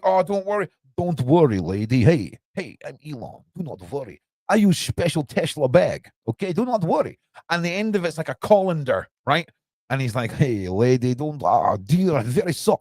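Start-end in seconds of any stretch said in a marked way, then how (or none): 0.0-7.2 mock foreign accent, swinging pitch; Elon. Tech boss reassuring a lady about his special Tesla bag, cartoon accented voice. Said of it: "this is how he sounds in my brain". 10.3-12.8 lightly hammed-up foreign accent; a hint of a bumbling accented caricature; Elon. Tech boss apologising to a lady, mock accented voice. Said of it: "Elon has become some obtuse accented person".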